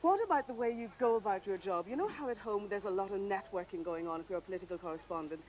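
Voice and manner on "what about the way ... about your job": ordinary talk, nothing marked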